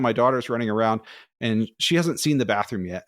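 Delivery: ordinary delivery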